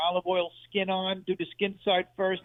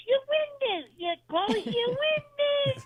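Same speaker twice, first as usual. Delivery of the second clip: very high, slow crying aunt voice; high-pitched aunt; much slower than usual. Retold or imitated. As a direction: whine like a bee at a picnic. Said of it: "Like a bee at a picnic, the high-pitched voice".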